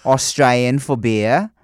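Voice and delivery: loud, hammed-up Aussie; Australian accent attempt. Shown as a demonstration. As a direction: punch it out in mock Australian. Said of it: "an equation for Australian accent".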